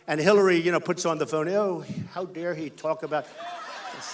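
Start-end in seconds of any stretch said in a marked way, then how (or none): 1.5-4.1 mock indignant. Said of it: "she acted indignant".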